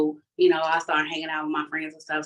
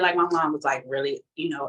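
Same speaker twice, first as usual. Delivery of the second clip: usual speaking voice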